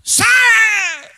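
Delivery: high, loud, hammed-up command; imitating the shout he held back, high and loud; mock exorcism shout. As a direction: bellow it to show what he could not do. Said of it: "no me podía poner a gritar".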